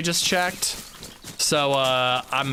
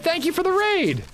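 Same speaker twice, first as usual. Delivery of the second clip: excited thanks. Real, not acted. Thanking a raider.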